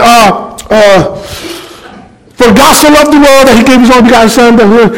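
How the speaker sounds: fast, high, flustered recitation; nervous stammer. Retold or imitated. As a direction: rush the verse out, caught off guard. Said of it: "I'm still stuttering".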